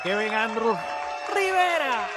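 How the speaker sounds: Rivera with a long rolled R, pitched high; rolled R's. Shown as a demonstration. Guest approving his name said properly, rolled R's. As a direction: roll the R in Rivera with a high flourish. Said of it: "You gotta roll the R's".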